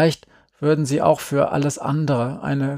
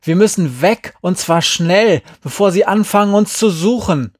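raised and loud, building in urgency; urgent protest; 6 semitones higher, 7 dB louder than usual. A narrator's reading. Boy warns they will be searched for, urgent calling. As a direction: press them hard to get away. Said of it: "rief Glenn".

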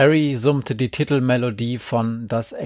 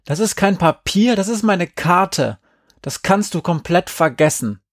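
high-pitched, raised-voice protest; called-out protest; 6 semitones higher, 4 dB louder than usual. A narrator's reading. Andi defending the map from friends, calling out in protest. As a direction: protest loudly, pitched well up. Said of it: "rief ich".

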